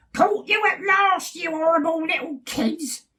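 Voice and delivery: high, loud screeching witch; cackling witch. Acted. Witch catching the bears eating her house, shrieking. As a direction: cackle it like a wicked old witch. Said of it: "a voice cackled".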